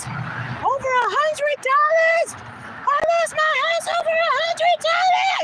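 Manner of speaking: very high, loud, drawn-out wail; retold screaming. Granddaughter re-enacts grandma losing her house, screaming. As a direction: scream it slowly, imitating a distraught grandma. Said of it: "all she kept screaming over and over".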